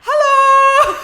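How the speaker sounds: loud, high-pitched shouted hello; exaggerated greeting. Shop worker re-enacts greeting Australian customers, exaggerated shout. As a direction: belt out a hammed-up greeting.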